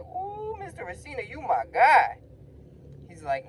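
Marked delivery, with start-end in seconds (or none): 0.0-2.2 high ooh building to a loud "my guy"; thrilled cheering. Retold or imitated. Student cheering Mister Racina for defending him, thrilled. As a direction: swoon high, then burst out loud for your champion.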